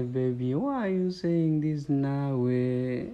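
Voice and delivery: ordinary talk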